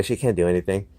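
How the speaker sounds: ordinary talk, nothing marked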